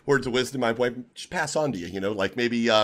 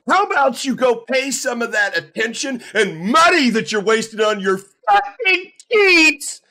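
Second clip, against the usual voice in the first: high, full-force enraged scream; furious screaming rant; 14 semitones higher, 9 dB louder, much slower than usual. Real, not acted. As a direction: blow up and scream at the cheater. Streamer's rant at a suspected cheater.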